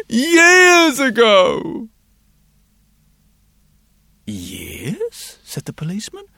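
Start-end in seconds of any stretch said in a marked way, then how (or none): 0.1-1.9 put-on high, loud and drawn-out child's answer; a small boy stretching the truth; little boy voice. A narrator's reading. Boy's voice in story. 4.3-5.4 lower, softer, puzzled question; a policeman doubting the boy; surprised policeman. A narrator's reading.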